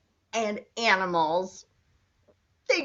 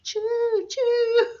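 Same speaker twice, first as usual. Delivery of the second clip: laughing choo-choo; 12 semitones higher, 4 dB louder than usual. Acted. Train sound with laugh.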